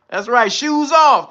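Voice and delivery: loud, slow dojo command; sensei command. Pretend. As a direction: bark it like a sensei laying down the law.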